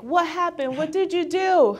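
high, loud, astonished friends asking; imitating friends' loud high amazement; amazed friends. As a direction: gush like shocked friends who can't believe the change. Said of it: "all my friends was like".

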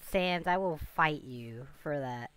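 ordinary delivery

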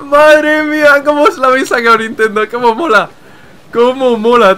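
high, loud, ecstatic gushing; real fanboy excitement at full volume; thrilled hype. Real, not acted. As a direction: shout it out, overjoyed and high-pitched. Excited reaction to a game reveal.

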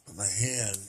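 walk them gently through the breaths; guided meditation. Real, not acted. Guiding a breathing exercise for viewers, low and soft.

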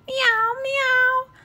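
high, drawn-out kitten mew; kitten meow. Acted. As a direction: mew like a small lost kitten. Kitten cries from far off.

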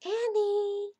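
high, loud, sustained name call. A narrator's reading. Jack answering Annie's call, hollering.

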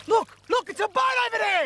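high, urgent excited cry; excited shout. Acted. Castaway spotting a boat for his mate, excited shout. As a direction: call out a sighting at the top of the voice. Spots a boat, rescue in sight.

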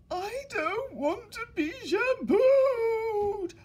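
high, loud, slow, hammed-up crying wail; wailing boy. The boy in the bath wails at his mother, hammed-up crying. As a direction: whine it out through mock tears. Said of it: "wailed the boy in the bubbles".

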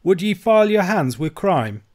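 high, loud, shocked question; horrified Scots protest; cried out. A narrator's reading. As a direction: cry it as the horrified old uncle. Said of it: "cries Ebenezer".

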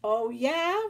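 loud, high mocking challenge; taunting reply. A narrator's reading. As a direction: throw it back as a dare. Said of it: "replied Leonardo".

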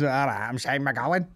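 put-on mumbled [name] accent intro; lightly imitated [name] accent; mock [name] accent. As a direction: slur the name like [name]. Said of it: "not an, like, an Irish accent".

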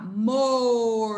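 "mor" very slow, the O held long; teacher's stretched-out "mor"; syllable demo. Shown as a demonstration. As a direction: draw "mor" right out so the O is heard. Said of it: "Olha lá".

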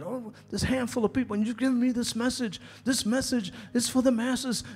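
high-pitched, rushed grumble, retold; mock complaining. Preacher retelling his old prayers to God, mock complaining. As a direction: act out pleading a grievance, pitched well up. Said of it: "I would complain to God".

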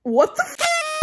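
shrieking what the f in fright; startled high shriek; startled yelp. Real, not acted. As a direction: jump out of your skin mid sentence. Startled mid birthday message.

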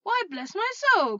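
high, loud, drawn-out amazement; big startled outburst; astonished cry. Acted. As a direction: exclaim in delighted shock. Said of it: "cried Fred".

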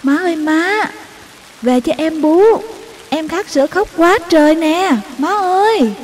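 high, loud, pleading shout; urgent shouted call. Acted. Child calls mother from the riverbank, urgent high shout. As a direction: call across a distance to mother. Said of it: "tôi gọi giật".

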